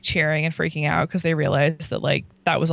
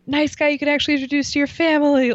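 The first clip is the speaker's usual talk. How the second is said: imitating the host, pitched up; high mock call; 8 semitones higher, 4 dB louder than usual. Host calling out to the crowd, high-pitched. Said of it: "you know, like".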